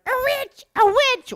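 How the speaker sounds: shrieked high and loud; mock witch-hunt shout. Villagers running around yelling, high panicked shout. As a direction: yell it like a frantic villager. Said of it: "the guys are running around going".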